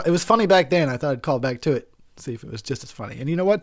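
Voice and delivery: ordinary delivery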